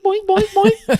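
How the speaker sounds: high comic boing sounds; mock boing noise. Denying eye contact with the waiter, mock high boings. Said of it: "I'm not going boing".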